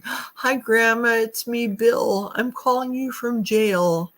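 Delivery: high, pleading grandchild calling from jail; a scammer faking a grandkid in trouble. Retold or imitated. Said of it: "impersonating a grandchild".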